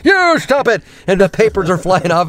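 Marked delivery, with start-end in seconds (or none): 0.0-0.8 high mock retort; imitated bickering character. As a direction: throw it back high, squabbling. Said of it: "he's just like, papers are flying".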